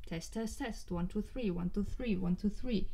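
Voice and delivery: soft and distant, from the side of the mic; off-axis mic test. Shown as a demonstration. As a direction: count out a sound check away from the microphone. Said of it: "you don't hear that much when I talk".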